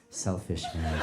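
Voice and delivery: low, soft passive-aggressive aside; muttered disapproval. Retold or imitated. Group judging whoever took the last piece, muttered disapproval. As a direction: mutter it low, under your breath.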